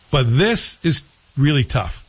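ordinary talk, nothing marked

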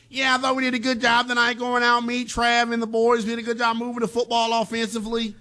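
high, monotone quarterback impression; Mahomes. Host redoing a post-game quote on request, high caricature voice. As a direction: hold the whole quote up on one high note, caricaturing an athlete. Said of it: "give me Mahomes again".

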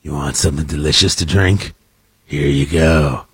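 very low, creepy movie-star voice; Harrison Ford. Acted. Host offering a kid a Halloween drink, creepy. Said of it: "channeling your inner Harrison Ford".

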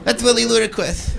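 high, lisping boxer voice; Mike Tyson. Acted. Comic has him marvel at a funny voice, Tyson impression. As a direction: put on a small, high voice with a lisp. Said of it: "even Mike Tyson's going".

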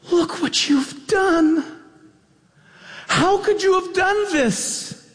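high, drawn-out cry of reproach; mock-horrified accusation. Scar blaming young Simba for the king's death, acted horrified reproach.

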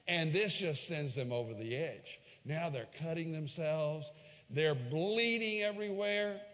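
normal talking voice

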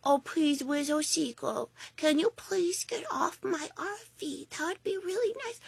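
very soft, meek, pleading voice; timid pleading. Retold or imitated. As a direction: beg the seagull politely and far too softly. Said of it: "you just go up to the seagull".

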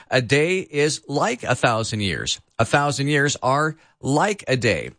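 "like" picked out with emphasis; stress on like. Shown as a demonstration. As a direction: lean on the word "like" each time. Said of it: "Emphasis on the word like".

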